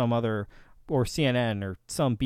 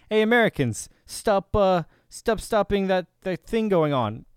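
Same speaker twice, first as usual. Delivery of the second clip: a higher, hammed-up Russian accent; exaggerated Russian accent; 5 semitones higher than usual. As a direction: redo the Russian accent, playing it up more. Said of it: "why am I doing a Russian accent?".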